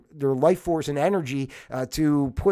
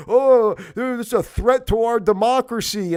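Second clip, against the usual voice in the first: sarcastic parroting, louder; mocking quote; 5 semitones higher, 6 dB louder than usual. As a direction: mimic them with scorn. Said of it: "It's like".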